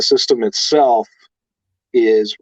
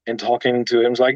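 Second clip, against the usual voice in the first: ordinary talk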